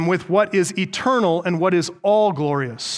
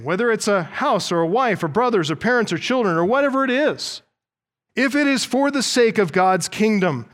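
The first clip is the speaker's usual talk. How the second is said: normal talking voice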